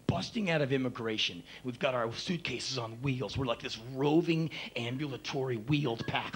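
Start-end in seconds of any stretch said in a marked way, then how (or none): none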